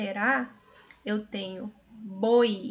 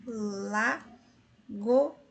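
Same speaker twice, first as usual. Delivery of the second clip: lagoa stretched very slowly, la-go-a apart; teacher separating lagoa syllables; slow syllable demo. Shown as a demonstration. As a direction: split lagoa into three slow beats. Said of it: "Aqui eu tenho hiato".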